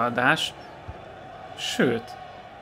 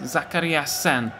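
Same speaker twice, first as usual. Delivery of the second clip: excited goal commentary; 2 semitones higher than usual. Real, not acted. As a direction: stretch the scorer's name like a commentator. Goal call in FIFA.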